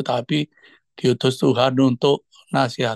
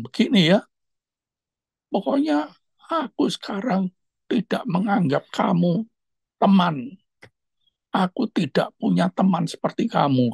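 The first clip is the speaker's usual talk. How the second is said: offended friend cutting him off, imitated higher; retold angry outburst; 7 semitones higher than usual. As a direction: replay the friend snapping that the friendship is over. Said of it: "Dia marah sekali".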